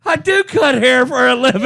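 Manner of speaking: hammed-up indignant heckler; heckler's voice. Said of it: "you just hear this voice".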